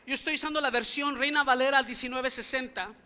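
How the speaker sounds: normal talking voice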